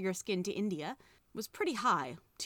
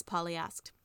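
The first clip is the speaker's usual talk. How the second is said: usual speaking voice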